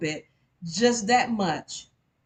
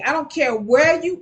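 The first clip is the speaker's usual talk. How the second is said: usual speaking voice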